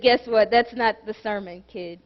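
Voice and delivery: soft and low, like a quiet divine nudge; God speaking. Acted. Preacher recalling God correcting his sermon plan. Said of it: "God said".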